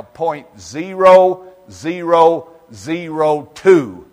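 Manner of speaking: each word hammered out slow and loud; slow emphatic. Real, not acted. Preacher to congregation, slow loud stressed number. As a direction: drag out the tiny number for effect.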